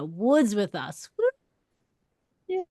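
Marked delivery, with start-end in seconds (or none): 1.2-2.6 a high whooping cheer of welcome; excited cheer. Real, not acted. Host cheering her guest's arrival, high excited whoop.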